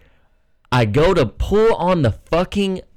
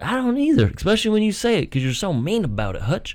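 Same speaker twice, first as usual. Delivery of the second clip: mock sweet reply; 7 semitones higher, faster than usual. Answering foul-mouthed Hutch to his face, mock sweet and high. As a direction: tease him by pretending to agree sweetly.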